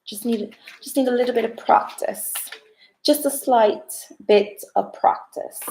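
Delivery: a hint of a joking British accent, slowed; half-hearted mock British accent, playful; trying a British accent. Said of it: "I'm gonna come back with an accent".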